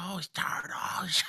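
slow, whispery cartoon villain imitation; Krang. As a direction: drag it out in a mock villain rasp. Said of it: "You sound like Krang".

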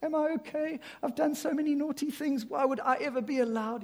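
high, unsure little voice asking to come back; a hint of a nervous, pleading sinner; timid pleading. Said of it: "Not groveling, kind of going".

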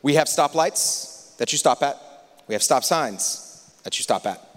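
ordinary talk

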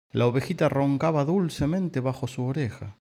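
usual speaking voice

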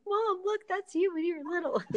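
put-on high, eager child pointing something out; a child's excited voice at the grocery store; excited kid voice. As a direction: play the little kid tugging mom's sleeve in the aisle. Kids spotting her on raisin box.

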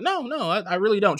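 high-pitched, placating denial; retold protest. Placating her during retold quarrel.

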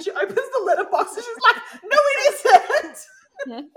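laughing. Real, not acted. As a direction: burst out laughing mid-sentence. Laughing retelling a joke.

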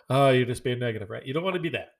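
ordinary talk, nothing marked

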